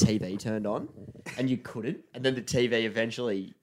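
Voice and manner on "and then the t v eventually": ordinary delivery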